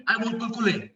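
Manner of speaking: Kulkule with L for each R; toddler mispronunciation. Retold or imitated. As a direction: imitate a small child asking for Kulkule, Rs turned to Ls. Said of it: "They cannot pronounce the sound of R".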